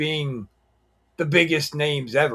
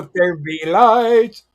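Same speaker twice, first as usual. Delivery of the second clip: mock biblical; 4 semitones higher, 5 dB louder, slower than usual. Joking about screen lighting.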